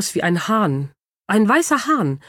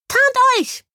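very high, bright squeaky command; high-voiced hamster call. A narrator's reading. Hamster ordering his team to hide, high-voiced call. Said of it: "rief plötzlich der Hamster mit einer hellen Stimme".

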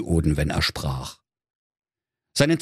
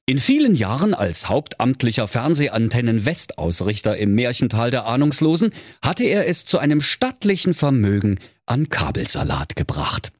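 ordinary delivery

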